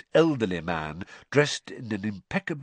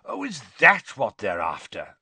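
jovial Bagman. A narrator's reading.

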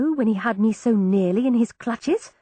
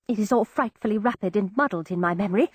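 usual speaking voice

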